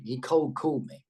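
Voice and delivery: usual speaking voice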